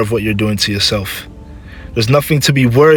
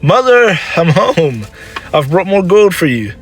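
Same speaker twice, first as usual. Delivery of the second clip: high, cheerful homecoming call; 8 semitones higher, 3 dB louder than usual. Acted. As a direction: announce yourself to mother from the doorway. Son calls out on arriving home.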